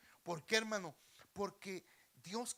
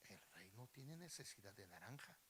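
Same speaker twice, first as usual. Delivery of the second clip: low, hushed thinking aloud; private musing; 7 semitones lower, 12 dB quieter than usual. Acted. Beggar weighing his melon alone, hushed musing. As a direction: reason softly to yourself. Beggar reasons to himself again.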